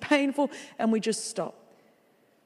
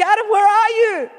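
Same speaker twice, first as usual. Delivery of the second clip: high, loud call across the garden; God calling out; 10 semitones higher, 7 dB louder than usual. Acted. God calls to Adam in the garden, loud call.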